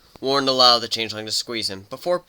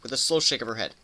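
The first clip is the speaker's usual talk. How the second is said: usual speaking voice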